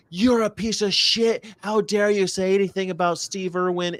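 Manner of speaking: a hint of indignant fans; mock outrage. Imitating angry Instagram commenters, mildly outraged. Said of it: "People like".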